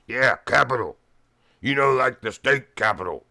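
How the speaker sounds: loud, gruff imitation of a big old biker; gruff big guy. Bricklayer on the phone to information, loud gruff voice. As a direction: bark it like a rough old bricklayer. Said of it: "he talks like this, and he's a big old gruff guy".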